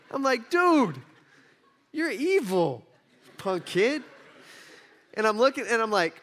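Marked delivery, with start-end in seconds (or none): 0.5-4.0 put-on high, drawn-out accusation of a child; hammed-up indignant dad; mock scolding. Acted scolding of lying son.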